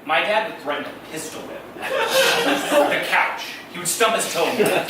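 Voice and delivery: normal talking voice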